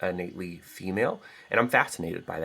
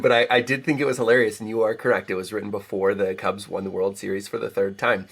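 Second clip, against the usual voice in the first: ordinary talk, nothing marked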